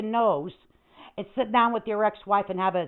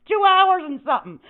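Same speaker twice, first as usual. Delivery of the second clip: exasperated outburst; 10 semitones higher, 7 dB louder than usual. Real, not acted. Complaining about bad stream.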